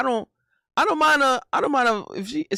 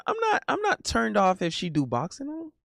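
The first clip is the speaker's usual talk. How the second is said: normal talking voice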